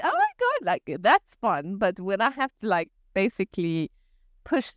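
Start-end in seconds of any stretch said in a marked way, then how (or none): 0.0-0.7 very high delighted squeal; excited squeal. Retold or imitated. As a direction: squeal like a thrilled student.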